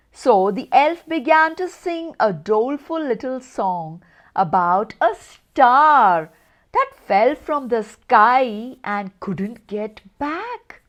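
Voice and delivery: usual speaking voice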